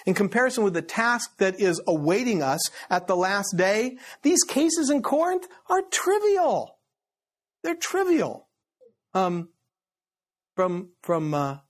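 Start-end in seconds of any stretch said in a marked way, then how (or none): 3.6-8.5 high, insistent emphasis on "trivial"; "trivial" said high and scornful; emphatic. Real, not acted. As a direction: drive home "trivial". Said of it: "preaching emphasis".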